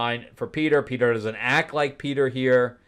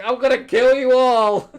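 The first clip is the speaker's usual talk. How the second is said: loud, high mock battle cry; hammed-up, high-pitched yell; mock threat; 10 semitones higher, 8 dB louder than usual. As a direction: imitate a kid threatening everyone. Acts out kid threatening old pirates.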